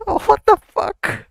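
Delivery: high, slow, squeaky shocked cry; shocked squeal. Real, not acted. Reacting to the shocking scene on screen, high squeal. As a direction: squeal it out in disbelief.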